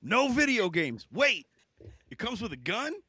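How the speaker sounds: mock boomer dad impression; American boomer dad. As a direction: play a stern boomer suddenly won over. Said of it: "most American boomer thing".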